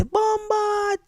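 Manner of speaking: high, drawn-out holler; imitated call-out. Man calling to a Jamaican in Spain, high holler. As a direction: holler it across a street.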